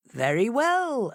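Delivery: slow, lordly fox consent; fox agreeing. A narrator's reading. Fox accepting the ride, slow and grand. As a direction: grant it graciously. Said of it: "agreed the fox".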